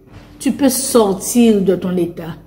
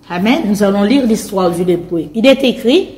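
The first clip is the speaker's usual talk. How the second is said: ordinary delivery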